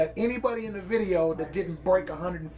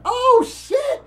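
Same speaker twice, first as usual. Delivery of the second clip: surprised; 7 dB louder than usual. Retold or imitated. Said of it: "I'm like".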